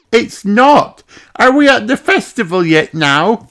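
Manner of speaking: put-on high little girl pig, eager; young piglet asking, impatient; child's high eager voice; Peppa voice.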